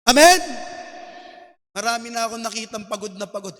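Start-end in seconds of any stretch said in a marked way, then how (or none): 0.1-0.4 louder, rising sermon climax over music; preaching at full force. Acted. Pastor urging the congregation, building passionate preaching. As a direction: stir the church toward the altar call.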